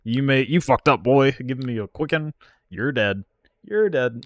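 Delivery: loud, taunting mock threat; voicing the opponent. Streamer voicing the opponent as a gloating, loud mock threat. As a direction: ham up the gloating rival. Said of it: "They said".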